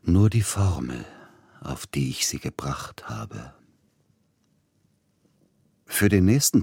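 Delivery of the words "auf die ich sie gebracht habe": breathy whisper, dropped right down; a secret confided in a whisper. A narrator's reading. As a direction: let the last words fall to a hushed whisper. Inner monologue, closing reflection.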